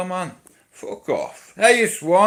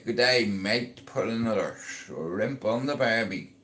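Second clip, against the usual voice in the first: a light mock Australian accent, softer; 4 semitones lower, 5 dB quieter than usual. Vlogger greeting his Australian viewers in a jokey Australian accent. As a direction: toss off a cliché Aussie greeting.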